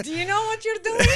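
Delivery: high, loud and fast through laughter; laughing mock client. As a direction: laugh while playing the doubtful client. Mocking a doubtful client, laughing.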